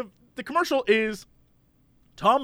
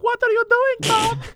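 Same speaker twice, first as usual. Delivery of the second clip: much higher put-on wife voice; 14 semitones higher than usual. Wife calling to her husband about the mattress, high put-on voice. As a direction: call out puzzled as his wife. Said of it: "And his wife is like".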